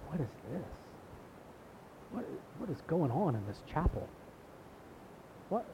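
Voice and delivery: hushed, baffled murmur imitating stunned onlookers; bewildered royals. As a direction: mimic a shocked, whispery aristocratic disbelief. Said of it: "It was almost like".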